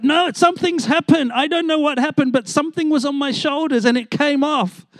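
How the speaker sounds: he can't believe what he felt; pitched high, pleading to be believed; excited insistence. Retold or imitated. Student insists something happened.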